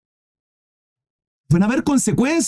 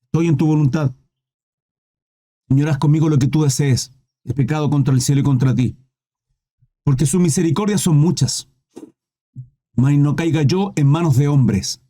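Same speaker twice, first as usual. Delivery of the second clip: submissive David; 6 semitones lower than usual. Retold or imitated. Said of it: "Se comporta varonilmente".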